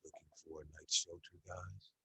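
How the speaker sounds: whisper-soft and menacing; chilling soft voice. A narrator's reading. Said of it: "chilling, soft voice".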